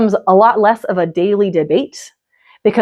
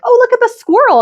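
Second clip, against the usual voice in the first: put-on much higher, excited sing-song to a toddler; bright distraction voice; 3 dB louder than usual. Parent distracting a little kid, excited and high. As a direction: point out the squirrel like it's thrilling. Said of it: "you can just be like".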